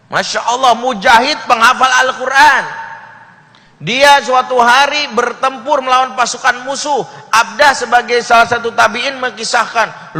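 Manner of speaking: loud, high preaching at full force; fiery preaching. Acted. Preacher warning the congregation, loud and fervent. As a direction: preach it hard to the crowd.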